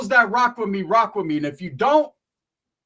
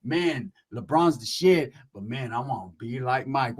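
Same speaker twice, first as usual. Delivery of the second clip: slow, low quoted voice; 7 semitones lower than usual. Retold or imitated. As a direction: drop low and stretch the words out slowly. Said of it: "What did A I said?".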